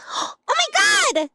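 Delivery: high excited shrieks; shrieking jackpot winner. Real, not acted. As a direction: squeal with delight at the subs. Sub alerts, excited streamer.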